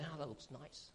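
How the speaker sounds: soft, hushed admiring murmur; eyeing a friend's plate, lightly acted; mock wistful aside. Preacher mimics coveting friend's pizza, hushed. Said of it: "I look at his pepperoni and say".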